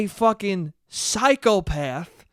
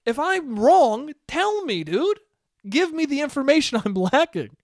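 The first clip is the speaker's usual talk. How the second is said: higher, hammed-up humble admission; self-deprecating; 7 semitones higher than usual. Admitting ignorance to his accountant friend, hammed-up and higher. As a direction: play up how clueless you are.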